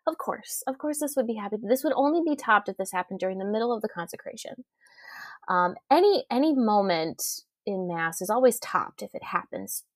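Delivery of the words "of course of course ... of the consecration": half-whispered aside to her husband, voice softer; a quiet, amused mutter meant only for him. Retold or imitated. To her husband during the homily, a soft chuckling mutter. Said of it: "I was like".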